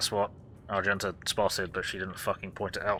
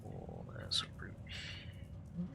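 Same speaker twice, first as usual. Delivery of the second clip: slow hushed whisper; 4 semitones lower, 12 dB quieter than usual. Real, not acted. Creepy exploration, hushed.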